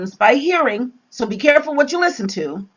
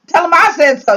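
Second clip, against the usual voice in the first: loud, higher parental command; 7 semitones higher, 6 dB louder, faster than usual. Retold or imitated. Preacher voicing parents' final word, loud and higher. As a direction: lay down the law like a parent. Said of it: "the parents".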